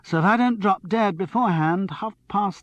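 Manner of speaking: a hint of a breathless, excited girl; excited tipsy young woman.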